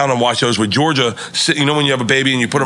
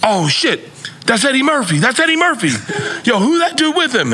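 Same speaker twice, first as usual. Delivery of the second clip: much higher excited chatter; excited crowd; 11 semitones higher than usual. Retold or imitated. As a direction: gasp like starstruck clubgoers. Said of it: "everyone's like".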